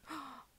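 sharp delighted gasp; a quick in-breath of surprise. Real, not acted. Fan pulling a rare card, sharp gasp.

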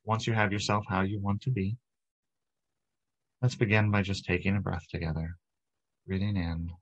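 calm, unhurried meditation guide; guided meditation. Acted. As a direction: lead them into relaxation, leaving long pauses between phrases. Leads guided breathing and relaxation meditation.

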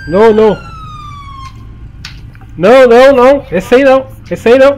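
loud repeated no; shouting. Real, not acted. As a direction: yell no, no, no. Yelling no at someone.

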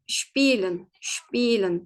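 "spielen" starting with shp, long ee; German pronunciation demo. Shown as a demonstration. Teacher models S-P rule, "spielen" with sh onset. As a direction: say "spielen" as SHPEE-lın. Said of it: "Bu şekilde okuyoruz".

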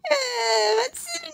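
high-pitched wailing plea; whiny, tearful cry. Acted. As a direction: wail and beg not to be left. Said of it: "ما كفايه عياط".

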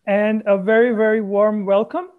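loud, bright host greeting; hearty emcee welcome to the audience; excited welcome. Real, not acted. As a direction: open the show with a big hello. Host opening the event.

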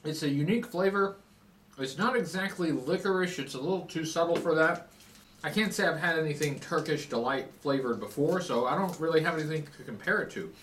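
speaking around candy; talking while chewing. Real, not acted. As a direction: mouth partly full. Seen 1: Lips pressed and cheeks bulging while talking; he ate earlier.

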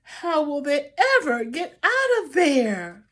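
ordinary delivery

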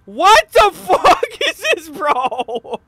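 loud, high laughing disbelief; shocked laughing disbelief. Real, not acted. Shocked at weird game.

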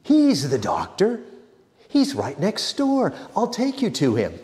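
quick, high and eager to help; swift sympathy. A narrator's reading. Ida hurries to send the Brahmin to her father, high and eager. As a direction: rush to point him next door. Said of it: "in swift sympathy".

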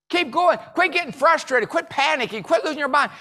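high, driving pep talk; urgent self-urging. Acted. Chopping a tree that won't fall, urgent urging. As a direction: push each command out high and insistent.

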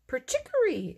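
very high piping chirp; bird call. A narrator's reading. Mole's whistle calls a yellow bird, high piping. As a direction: pipe it like a whistle. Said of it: "it called".

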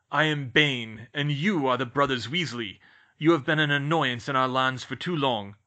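loud, imperious declaration; stern centaur, hostile. Acted. Bane confronts the brothers Weasley, stern and loud. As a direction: pronounce it as a slighted lord.

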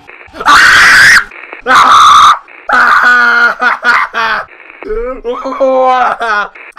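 long, very loud high-pitched scream; real shriek of fright that breaks into shorter cries. Real, not acted. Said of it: "fully heard my girl scream in full effect".

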